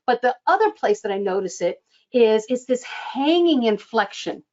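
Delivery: ordinary delivery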